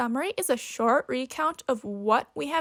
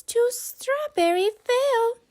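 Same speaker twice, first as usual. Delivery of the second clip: high squeaky little mouse voice; bright squeak of an eager mouse; 9 semitones higher, 4 dB louder than usual. Acted. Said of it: "squeaks Mouse".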